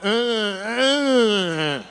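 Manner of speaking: hammed-up idiot stammer; dumb stalling. Finger in mouth, mock dumbfounded stammer. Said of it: "laying there like an idiot going".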